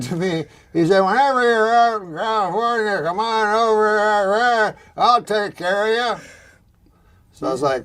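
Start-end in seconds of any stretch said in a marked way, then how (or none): 1.0-6.2 high, loud garbled slur; Larry Flint. Retold or imitated. Larry Flint invites him to California, garbled and high. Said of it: "when Larry Flint says".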